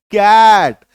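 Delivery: कैट said loud with a long, pushed ae vowel; forceful cat. Shown as a demonstration. Teacher demonstrating forceful कैट to students. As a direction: lean hard on the vowel of कैट. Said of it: "थोड़ा जोर लगा के करेंगे".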